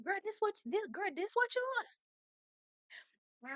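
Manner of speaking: high, slow, soft mocking girl voice.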